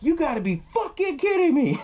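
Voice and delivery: high, hammed-up disbelief; exasperated outcry. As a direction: wail it in mock exasperation, pitch way up. Characters react to sudden rain.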